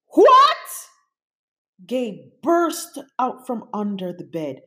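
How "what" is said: very high, loud outburst; shocked. A narrator's reading. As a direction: shout it in shock. Monster shocked.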